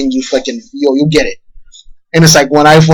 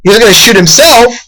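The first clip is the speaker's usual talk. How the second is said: ordinary delivery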